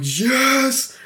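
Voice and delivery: sudden high delighted yes; excited yes. Retold or imitated. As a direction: shoot up high with joy. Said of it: "he's like".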